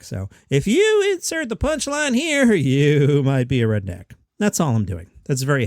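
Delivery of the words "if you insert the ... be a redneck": high comic setup sinking to a slow, normal-pitched payoff; half-ass Jeff Foxworthy. Shown as a demonstration. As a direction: start very high, then drop for the punchline. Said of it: "start up high and you kinda drop your voice".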